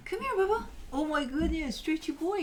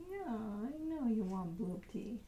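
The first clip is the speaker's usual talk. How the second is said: normal talking voice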